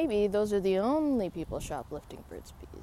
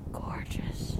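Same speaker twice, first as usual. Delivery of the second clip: breathy whisper of awe; whispered admiration; 4 dB quieter than usual. Real, not acted. Owner admiring a beautiful passing dog, whispered.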